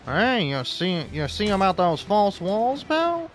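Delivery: high, loud, needling whisper-in-your-ear conspiracy voice; paranoid inner voice. Retold or imitated. Gamer voicing the paranoid voice in his head, high and loud. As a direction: play the sly paranoid voice nagging him about false walls. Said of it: "paranoid-fueled little voice in my head going".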